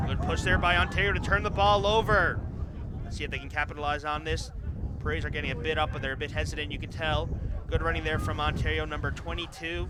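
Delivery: rising excitement calling the turnover; excited radio call of the play; excited commentary. Real, not acted.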